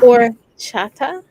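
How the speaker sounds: slow loud gringo horchata; American accent Spanish. Shown as a demonstration. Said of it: "I say it like a gringo".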